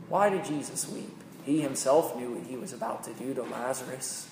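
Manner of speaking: ordinary talk, nothing marked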